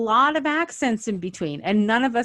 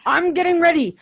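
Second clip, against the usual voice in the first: character voice; 9 semitones higher, 6 dB louder, faster than usual. Pretend. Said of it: "voicing a character on screen".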